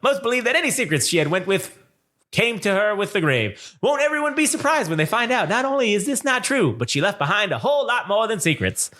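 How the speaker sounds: put-on high, old-time radio announcer voice; dramatic vintage radio narration; 1930s radio announcer. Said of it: "nineteen thirties radio guy's voice".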